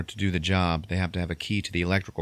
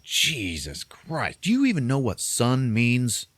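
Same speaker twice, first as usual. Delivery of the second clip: long drawn-out mock disgust, then higher; appalled outburst; 5 semitones higher, slower than usual. Son appalled at his mom's rant, exasperated. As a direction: stretch the oath, then challenge her.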